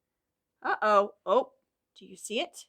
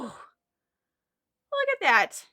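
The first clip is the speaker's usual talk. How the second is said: ordinary talk, nothing marked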